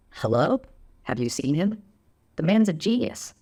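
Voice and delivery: mock-incredulous, pitch leaping up at the end; incredulous retort. Supporter retorting to people questioning her, incredulous. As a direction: answer as if it were utterly obvious. Acted retort to critics of Trump.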